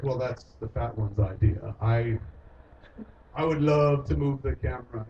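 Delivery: imitating Dave. Voicing Dave the cameraman.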